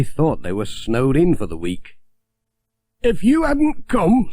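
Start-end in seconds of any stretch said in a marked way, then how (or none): 3.0-4.3 put-on high, slow old farmer voice; a grateful old countryman; Alf's voice. A narrator's reading. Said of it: "said Alf".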